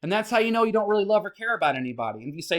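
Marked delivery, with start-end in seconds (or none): none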